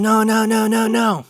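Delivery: high, sustained mock scream; imitated screaming child. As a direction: imitate a kid wailing in refusal. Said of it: "he's screaming and shouting".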